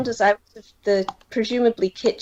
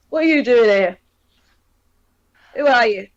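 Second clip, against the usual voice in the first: a touch louder, curt challenge; gruff guard captain; 4 dB louder, faster than usual. Acted. Captain challenges strangers bursting in, gruff. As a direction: demand who they are, gruffly. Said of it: "he looks up and goes".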